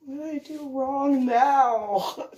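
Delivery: high, slow plaintive whine; whiny lament. Retold or imitated. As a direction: moan it like a doomed private. Called back by an officer.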